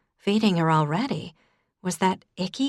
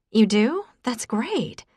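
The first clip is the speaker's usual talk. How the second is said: delighted; 6 semitones higher, slower than usual. A narrator's reading. Faith delighted he has list.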